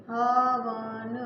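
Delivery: हवन stretched very slowly, "haaa-vaaan"; sounded out slowly. Shown as a demonstration. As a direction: draw out each syllable of हवन to expose its sounds. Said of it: "अब का sound आ रहा है".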